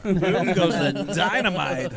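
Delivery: loud, slow, laughing; excited laughing exclaim. Real, not acted. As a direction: belt it out with a laugh. Celebrating a 180 on stream.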